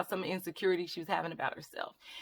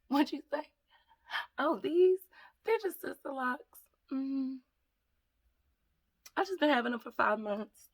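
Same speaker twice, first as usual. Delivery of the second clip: higher, playful show-off voice answering an imaginary compliment; being extra; 6 semitones higher than usual. Pretend. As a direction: pretend someone just asked about your locks, act coy and sassy. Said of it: "I'm ex-, being extra".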